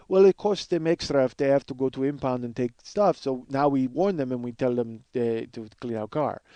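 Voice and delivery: Russian repo man explaining; Russian accent. Acted. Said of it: "I love doing this Russian guy's voice".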